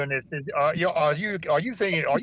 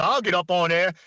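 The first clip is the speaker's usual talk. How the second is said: ordinary talk, nothing marked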